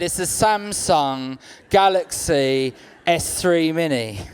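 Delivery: slow, each word savoured; slow proud announcement. Acted. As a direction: spell out the phone name with mock pride. Proudly naming his old phone.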